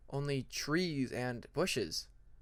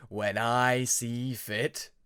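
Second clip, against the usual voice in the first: menacing dragon; 4 semitones lower, much slower than usual. Acted. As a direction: pronounce his doom slowly. Hidden dragon threatening.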